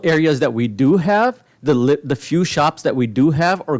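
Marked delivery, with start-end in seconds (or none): none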